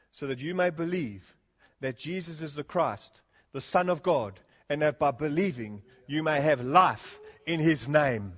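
usual speaking voice

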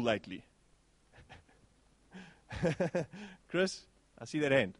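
ordinary talk, nothing marked

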